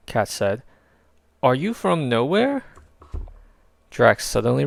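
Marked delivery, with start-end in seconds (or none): 1.4-2.6 put-on higher, delighted girl; a bright impressed young girl; girl thinks the name is cool; Katz voice. A narrator's reading.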